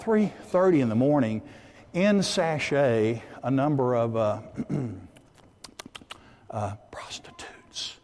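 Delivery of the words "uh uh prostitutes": halting, near-whispered aside; hushed delicate word. Real, not acted. Preacher hesitating to name the women in the diner, hushed. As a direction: drop to a whisper, as if embarrassed.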